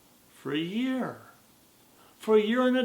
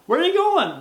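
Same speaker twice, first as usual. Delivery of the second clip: high, loud chirpy voice; a puny tree, high and loud; little tree; 9 semitones higher, 12 dB louder than usual. Acted. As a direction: call out as an eager tree. Tree asks.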